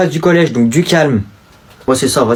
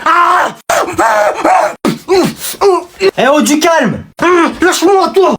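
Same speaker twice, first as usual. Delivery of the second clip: cry out; 11 semitones higher, slower than usual. Acted. Scuffle, crying out.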